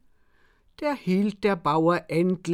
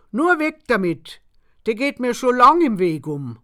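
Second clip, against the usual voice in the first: higher and louder, waving it off; dismissive; 6 semitones higher, 7 dB louder than usual. A narrator's reading. Farmer insists the tree must go, loud and high. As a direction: get rid of the thing, emphatically. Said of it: "wehrte der Grashofer ab".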